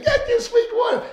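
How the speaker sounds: high laughing mock hawker cry; vendor call. Seller heard by the boy, mock hawking call. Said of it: "hears this guy with the watermelon saying".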